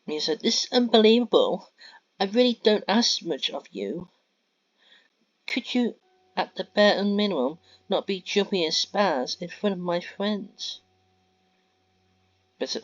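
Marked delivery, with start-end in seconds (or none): 0.4-10.8 loud, higher, exasperated tirade; angry scolding girlfriend. Acted. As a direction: tear into him, fed up and shrill with anger. Mia scolding Ben publicly.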